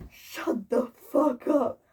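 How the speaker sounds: slow, sneering put-on hater voice; imitating hater. Mocking a hater telling her to shut up. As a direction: drag it out like a snide troll.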